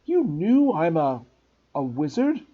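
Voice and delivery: slow, loud, stunned question; can't believe it. Acted. Harry stunned.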